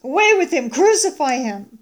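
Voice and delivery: loud, rejecting shouts; crowd's cry. Acted. Crowd rejecting Pilate's king, crying out. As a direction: shout him away like a mob. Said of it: "they cried out".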